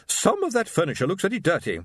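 high, haughty matron summoning her husband; imperious wife. A narrator's reading. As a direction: sharply call your husband to order. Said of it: "said Missus Rutherford".

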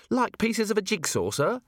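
a keen junior officer reporting up; young dwarf Cheery. A narrator's reading. Cheery nervously reporting her test to Vimes, eager.

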